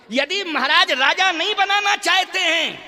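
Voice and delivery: loud, high, aggrieved mother's appeal; impassioned mother. Acted. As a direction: raise the voice as a wronged mother. Kaushalya speaks to Ram, acted. (आहत माँ की ऊँची आवाज़)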